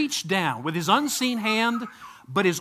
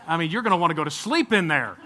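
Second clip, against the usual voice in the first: ordinary talk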